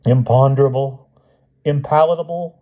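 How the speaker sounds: high protest climbing word by word; rising, high-pitched storybook outcry; shouting Hyde. A narrator's reading. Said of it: "shouted Hyde".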